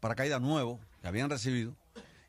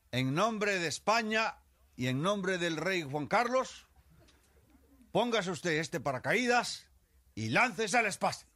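slow, loud, higher ceremonious command; solemn officer's order; 11 semitones higher, 5 dB louder, slower than usual. Acted. Spanish general orders little Gómez to jump: slow formal proclamation. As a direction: pronounce it grandly like a general.

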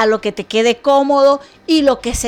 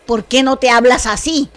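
normal talking voice

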